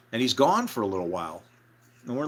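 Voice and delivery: ordinary talk, nothing marked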